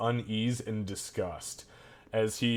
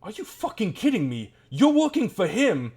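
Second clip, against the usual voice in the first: higher, loud outraged yelling; furious guard; 7 semitones higher, 6 dB louder than usual. Acted. Guard catches his comrade with the diamond, furious high yell. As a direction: snap at the traitor in disbelief. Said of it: "and he says".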